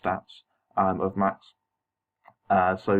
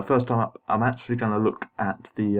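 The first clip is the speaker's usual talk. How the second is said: ordinary talk, nothing marked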